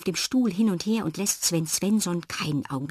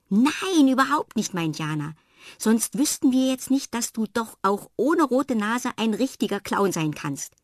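higher, louder, emphatic reassurance; eager insistence; 6 semitones higher, 4 dB louder than usual. Acted. Jana insists to Fred that the search mattered, eager. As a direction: convince him it was worth it.

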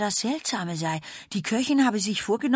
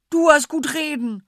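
upset cry; 7 semitones higher, 8 dB louder than usual. Acted. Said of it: "ruft sie".

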